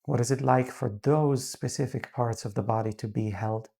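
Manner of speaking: calm, unhurried meditation voice with long pauses; guided meditation. Real, not acted. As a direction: gently guide them into grounding, leaving space between phrases.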